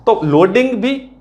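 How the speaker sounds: ordinary talk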